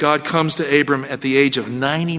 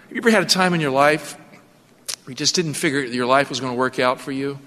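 ordinary talk, nothing marked

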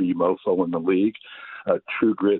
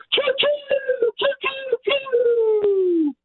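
high train-whistle choo-choo, yelled; choo-choo cheer; much slower than usual. Acted. Caller closes his Chiefs rant with a train-whistle choo-choo.

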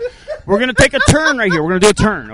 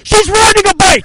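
excited shout; 9 semitones higher, 6 dB louder than usual. Real, not acted. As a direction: cheer it out, high and loud. First ride success, cheering.

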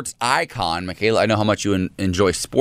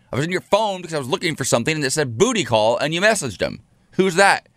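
retold angry confrontation; 6 semitones higher than usual. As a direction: build the accusation to a shout. Confronting husband about booty call.

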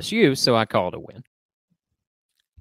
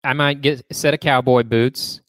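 usual speaking voice

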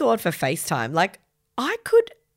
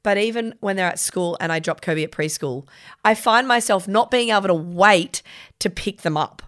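ordinary talk

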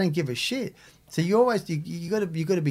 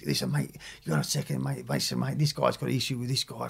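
put-on lower, softer voice of an old lag settling a beef; calm mediator inmate; 3 semitones lower, 7 dB quieter than usual. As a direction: talk him down quietly like the senior con in the wing. Older inmates sorting out a dispute.

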